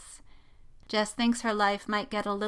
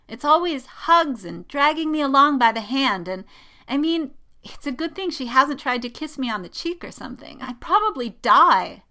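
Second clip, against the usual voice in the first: loud, high, melodramatic venting; dramatic complaining; 7 semitones higher, 8 dB louder than usual. A narrator's reading. Jessica vents to her best friend, melodramatic. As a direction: pour out a tale of woe.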